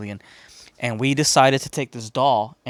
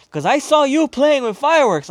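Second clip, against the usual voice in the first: much higher, louder accusing voice; accusing neighbor; 13 semitones higher, 8 dB louder than usual. Retold or imitated. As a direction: catch the kid out. Lady accusing the kid.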